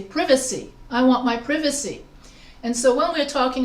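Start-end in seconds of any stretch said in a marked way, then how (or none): 0.0-0.7 "privacy" as PRIV-uh-see with a short i; British short-i "privacy", rhyming its start with give; British pronunciation. Shown as a demonstration. Teacher showing what they say in Britain, short-i "privacy". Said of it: "British English, they say". 1.4-2.0 "privacy" with a short i, PRIV-uh-see; British pronunciation. Shown as a demonstration. Teacher giving a British example, short-i "privacy". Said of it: "whereas British English, they say".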